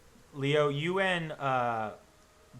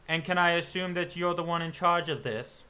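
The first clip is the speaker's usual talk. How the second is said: stern hunter; 5 semitones higher, 4 dB louder than usual. Acted. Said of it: "looks at his men".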